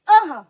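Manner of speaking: अह punched loud with an h release; short "aha" for अह; visarga breath. Shown as a demonstration. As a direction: drive the breath out at the end of अह. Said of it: "तो कैसे बोलेंगे".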